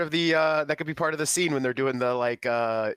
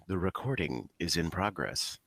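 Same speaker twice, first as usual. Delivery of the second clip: slow, low, softer robot announcement; robotic voice; 7 semitones lower, 6 dB quieter, slower than usual. Retold or imitated. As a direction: imitate a robotic recording notice, slowly. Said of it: "that weird robotic voice going".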